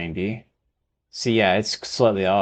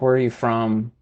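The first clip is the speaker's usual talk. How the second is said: ordinary talk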